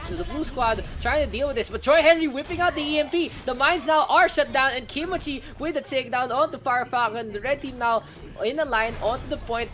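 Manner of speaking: high, loud, fast hype call; excited play-by-play. Real, not acted. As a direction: shout the teamfight like a caster. Big teamfight play-by-play.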